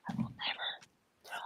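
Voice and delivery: breathy whispered vow. Real, not acted. The Yankee insisting she'll never be Southern, whispered. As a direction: whisper it, almost unvoiced. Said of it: "whispered vow".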